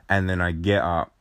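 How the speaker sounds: ordinary talk, nothing marked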